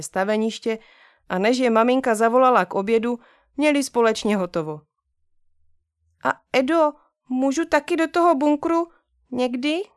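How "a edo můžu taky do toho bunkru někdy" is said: lightly voiced sister, high and careful; cautious little girl. Sister asks brother carefully, lightly high. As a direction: ask a bit timidly. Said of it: "Zeptala se ségra nakonec opatrně".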